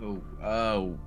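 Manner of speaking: normal talking voice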